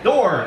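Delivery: "Door" blurted high and loud as a mangled Czech word; mispronounced door. Retold or imitated. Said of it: "mispronouncing the Czech word for door".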